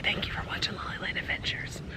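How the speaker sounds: hushed whispered sign-off; whispering. Real, not acted. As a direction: whisper it like in church. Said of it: "Why are we whispering?".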